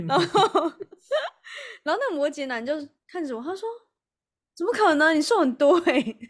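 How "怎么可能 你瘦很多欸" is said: higher and louder, imitating an astonished friend; retold disbelief, raised and loud. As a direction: act it out as if you barely recognize her. Friend can't believe her weight loss.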